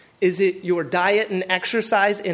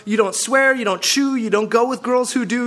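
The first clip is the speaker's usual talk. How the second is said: normal talking voice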